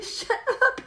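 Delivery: high, hushed shut up through giggles; stifled laugh. Retold or imitated. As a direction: try not to laugh while hushing.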